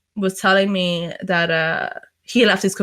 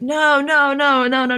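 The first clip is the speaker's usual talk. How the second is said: higher-pitched string of retold denials; repeated denial; 6 semitones higher than usual. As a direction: imitate someone denying it over and over. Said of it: "Denying it all the time".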